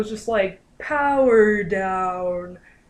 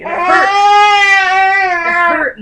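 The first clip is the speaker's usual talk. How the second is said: higher, louder yelp of pain; pained cry; 11 semitones higher, 13 dB louder than usual. Retold or imitated. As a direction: cry out as the latch hurts. Painful first latch.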